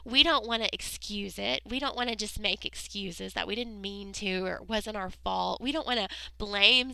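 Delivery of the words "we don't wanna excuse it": ordinary delivery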